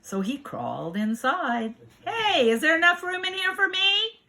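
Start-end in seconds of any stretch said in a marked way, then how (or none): 2.0-4.2 high, pleading little animal; hopeful animal. Acted. Animal asking the others for room, high and hopeful. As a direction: call in hopefully, pitched high. Animal asks to join the mitten.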